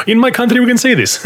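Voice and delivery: high, sing-song mock foreign voice; foreign teammate impression. Mocking how the teammate explains the mix-up, high and playful. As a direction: pitch it way up, playful mimicry.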